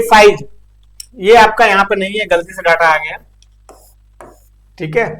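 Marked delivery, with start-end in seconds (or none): none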